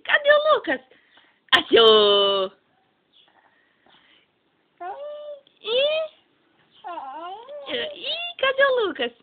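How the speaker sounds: high excited peekaboo calls; sing-song peekaboo, loud and high. Real, not acted. Peekaboo with baby Lucas. (brincando de esconde-esconde com o bebê)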